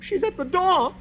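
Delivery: begging the others to see what he sees; rising hysteria; terrified. Acted. Guest trapped by the ghost.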